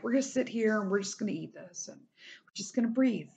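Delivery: soft, fast, calming friend voice; soothing. Shown as a demonstration. Comforting a friend in crisis over candy, softly soothing. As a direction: ease a distressed friend down gently. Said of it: "strike the soothing, like, a measured cadence".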